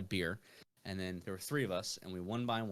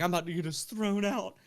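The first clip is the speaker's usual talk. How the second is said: high, panicked blurt; mock panic; 8 semitones higher, 3 dB louder than usual. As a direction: sound like you're about to be kicked out. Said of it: "I was like".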